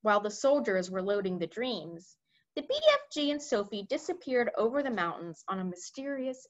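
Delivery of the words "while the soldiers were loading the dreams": plain reading voice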